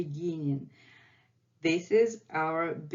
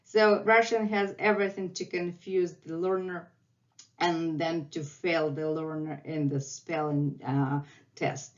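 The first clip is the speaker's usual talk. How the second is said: ordinary talk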